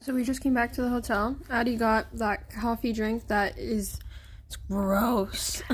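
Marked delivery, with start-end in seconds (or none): 4.5-5.6 low, drawn-out disgust on gross. Real, not acted. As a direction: say it like it tastes awful. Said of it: "I did not need to say gross that way".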